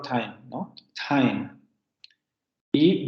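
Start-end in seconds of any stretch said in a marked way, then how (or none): 0.0-1.5 "time" said with an English alveolar T. Shown as a demonstration. Teacher showing a transcription example, English alveolar T in "time". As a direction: say "time" with the tongue tip on the ridge. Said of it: "se transcribe, por ejemplo".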